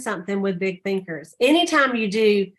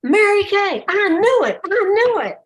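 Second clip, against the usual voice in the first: high and louder, imitating a thrilled fan; a playful imitation of gushing excitement; excited; 10 semitones higher, 6 dB louder than usual. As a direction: blurt it out like you've spotted a celebrity. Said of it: "he got so excited".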